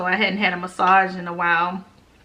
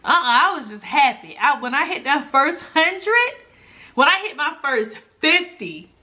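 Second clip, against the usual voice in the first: ordinary talk, nothing marked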